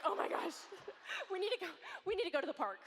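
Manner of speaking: high, rushed, half-laughing; suddenly desperate to copy them; mock frantic urgency. Mom seeing perfect photo, frantic mock urgency.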